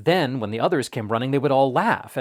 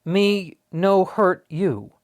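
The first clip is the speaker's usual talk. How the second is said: very slow, careful broken English; mock Indian English; 6 semitones higher, 4 dB louder, much slower than usual. A narrator's reading. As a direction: say it slowly, like to a stranger. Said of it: "in what he supposed was Indian English".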